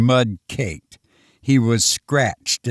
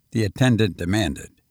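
plain reading voice

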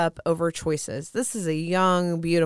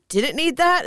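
higher, dry mock shrug at the dogs; sarcastic shrug; 10 semitones higher, 4 dB louder than usual. To her dogs, after they ate a crystal: high, dry mock shrug.

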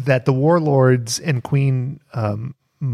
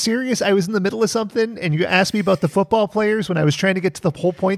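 mock outrage; 7 semitones higher, faster than usual.

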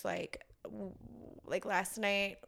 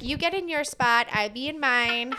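imitating a bossy toddler; 3 semitones higher, 8 dB louder than usual. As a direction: boss mom around like a tiny queen. Bossy toddler ordering mom.

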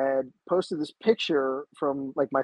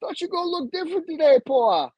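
much higher playful voice for the instructor; imitating instructor; 13 semitones higher, 4 dB louder than usual. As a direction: tease him about the beard. Instructor quoted on video chat.

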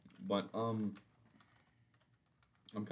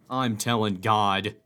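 loud, slow threat; childish threat; 14 dB louder, slower than usual. Pretend. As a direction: bellow it like a bratty kid. Climactic mock threat.

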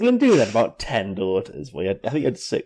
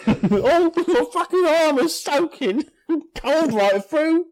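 very high, slow whiny king with a laugh; Henry the fourth voice; slower than usual. Acted. Co-host voicing the soaked king, very high slow whine. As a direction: drag out the soggy king's moan. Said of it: "same Henry the fourth voice".

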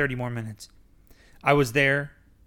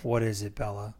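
nervous; 4 semitones lower, 7 dB quieter than usual. Acted. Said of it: "I said nervously".

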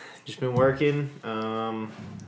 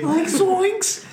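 squeaky high cartoon yelp; Scooby-Doo zoinks. Cartoon exclamation.

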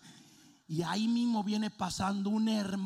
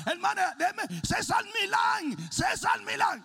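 high loud desperate calling; frantic pleading; 8 dB louder than usual. Retold or imitated. Pastor with a mouth of dog hair begging the sister, high loud plea. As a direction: beg her to stop and listen.

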